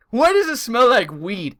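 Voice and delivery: slow, high, loud mom outrage; angry mom. Retold or imitated. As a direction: imitate a mother demanding answers. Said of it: "She was so angry and so pissed off".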